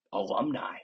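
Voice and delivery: ordinary talk, nothing marked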